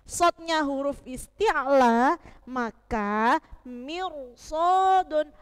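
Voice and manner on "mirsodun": Mirsodun very slow, ro read thick; heavy ro. Shown as a demonstration. Teacher demonstrates a thick ro for the class. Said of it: "roknya dibaca te-, tebal".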